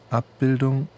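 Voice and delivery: normal talking voice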